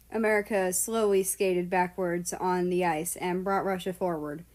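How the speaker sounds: ordinary delivery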